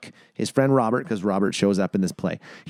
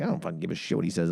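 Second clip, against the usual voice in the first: inner grumble; 6 semitones lower, 6 dB quieter, faster than usual. Retold or imitated. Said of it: "in my head, I'm like".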